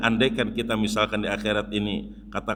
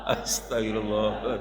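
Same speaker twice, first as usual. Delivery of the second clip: laughing; 2 semitones lower, slower than usual. Real, not acted.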